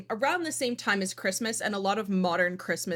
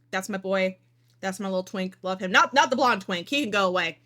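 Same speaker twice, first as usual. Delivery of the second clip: ordinary delivery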